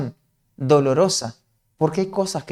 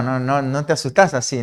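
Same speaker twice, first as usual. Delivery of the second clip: ordinary talk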